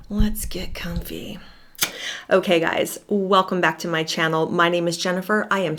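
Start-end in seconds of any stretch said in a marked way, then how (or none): 0.1-1.4 whisper. Real, not acted. Settling in before the video.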